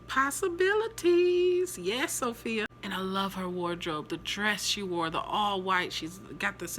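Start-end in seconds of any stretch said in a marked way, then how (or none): 0.1-1.8 drawn out, up high and slow; teasing musing. Acted. Wondering if Sophia is villain or love, drawn-out high tease. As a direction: savour the word, mysterious and coy.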